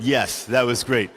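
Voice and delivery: ordinary talk, nothing marked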